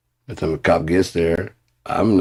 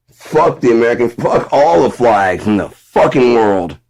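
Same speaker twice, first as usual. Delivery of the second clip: ordinary talk, nothing marked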